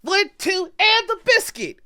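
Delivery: put-on very high, loud child's shout; little girl shouting a combo; kid's battle cry. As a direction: yell it like a kid throwing a punch. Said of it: "and just".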